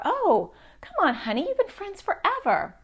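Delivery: high, sing-song brush-off; dismissive parent. Retold or imitated. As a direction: wave the feelings away, coaxing honey.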